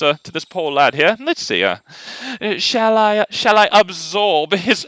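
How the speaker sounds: high, hammed-up posh British lord, chuckling; mock a smug British lord toying with his opponent; lordly British accent.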